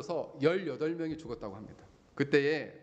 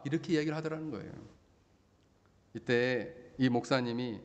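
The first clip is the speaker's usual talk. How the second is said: normal talking voice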